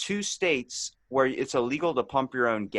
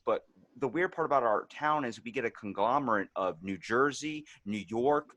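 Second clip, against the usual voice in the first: normal talking voice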